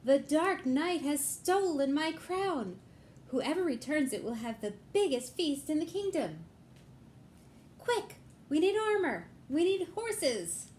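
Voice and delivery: a frantic king demanding help; upset king. Acted. King begging the knights to rescue his crown, high and urgent. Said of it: "It was the king".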